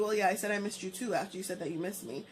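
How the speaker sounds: normal talking voice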